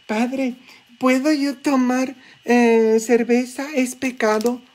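high, pleading parishioner's voice, way up in pitch; imitating a parishioner. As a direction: ask the priest like a timid churchgoer. Feligrés imitado preguntando al padre. (imitando a un feligrés con voz aguda y suplicante)

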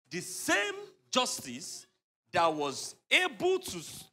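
slow, weighty build-up; slow and emphatic. Acted. Lawyer impressing on client how fearsome the judge is, slow and weighty. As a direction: spell out her record slowly and heavily.

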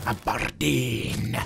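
put-on slow Aberdeen Scottish accent. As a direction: drawl the town name in Scottish accent. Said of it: "It's my Scottish accent".

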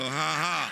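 a forced, deliberate ha ha; faith laugh. Shown as a demonstration. Preacher leads the church in a faith laugh. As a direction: fake laugh done on purpose. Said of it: "Y'all try a faith laugh".

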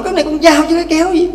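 husband insisting it's a knife, in a fast mock argument; mock angry husband. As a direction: toss the line back like a stubborn husband. Husband argues in story.

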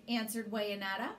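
plain reading voice

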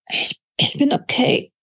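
hoarse whispered croak. Acted. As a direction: strain the words out of a hurt throat. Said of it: "krächzte sie".

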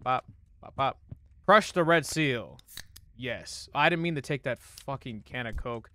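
ordinary delivery

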